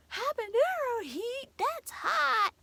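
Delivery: high mock startled husband voice; mimicking husband. Wife imitating husband shocked by habanero. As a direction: squeal it like he's alarmed. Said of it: "he's like".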